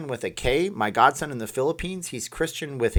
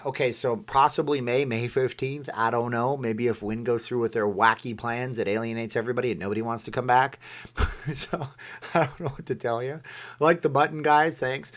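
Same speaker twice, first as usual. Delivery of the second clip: usual speaking voice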